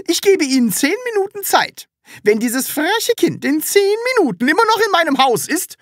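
very high, loud tirade; outraged, loud ultimatum; furious landlady. Acted.

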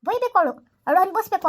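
father raging that his runaway daughter must suffer, in a loud bitter outburst. Acted. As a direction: let the resentment explode. Husband erupts about daughter.

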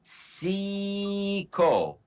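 "Seiko" stretched very long as SEEEE-koh; demonstrated pronunciation. Shown as a demonstration. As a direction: hold the EE in "Seiko", then a short koh. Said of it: "pronunciation for this is".

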